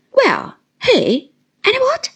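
a sharp, high snap of a question. A narrator's reading. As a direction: cut him off sharply, pitch jumping up. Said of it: "said my aunt sharply".

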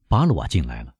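plain reading voice

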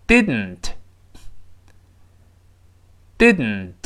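"didn't" said slowly, the dn released through the nose; slow nasal plosion. Shown as a demonstration. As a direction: hold the tongue after "did" and push the n through the nose. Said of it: "我们再慢慢读一下". (慢读 didn't，鼻腔爆破)

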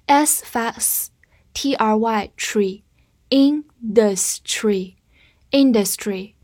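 drawn-out letters then the word; slow, deliberate model; slow sounding out. Shown as a demonstration. Teacher sounding out the end of industry for students. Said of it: "sounding out, slow".

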